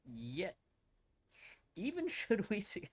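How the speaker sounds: a hint of Southern gentleman, breaking into a laugh; old Southern accent. Streamer reading the letter, mild Southern accent with a laugh. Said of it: "with that, that old Southern accent".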